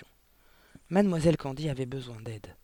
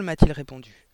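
ordinary delivery

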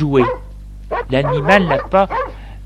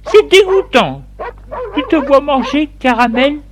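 high, louder wife voice; storyteller's high, appalled wife; disgusted wife; 11 semitones higher, 4 dB louder than usual. A narrator's reading. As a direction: cry out your disgust in a high voice. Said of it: "Elle lui dit".

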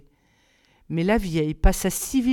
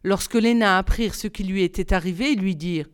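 ordinary delivery

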